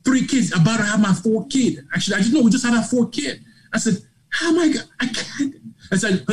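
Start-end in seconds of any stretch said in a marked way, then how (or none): 0.0-3.4 high-pitched, insistent listing of burdens; pleading excuses. Retold or imitated. Husband listing family responsibilities to his wife, high-pitched pleading. 4.3-5.5 very high, broken-off objection; overwhelmed protest. Retold or imitated. Husband telling his wife he can't quit, high-pitched protest.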